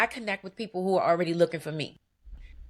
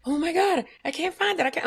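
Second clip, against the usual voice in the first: mock panic; 9 semitones higher, 3 dB louder than usual. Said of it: "I'm panicking".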